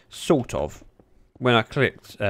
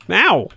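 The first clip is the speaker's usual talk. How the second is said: loud ow; 10 semitones higher, 11 dB louder than usual. Real, not acted. Hurt by mob in game.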